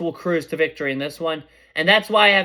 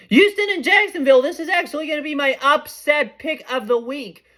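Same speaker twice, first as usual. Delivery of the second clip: excited; 7 semitones higher than usual. Real, not acted. Excited upset pick announcement.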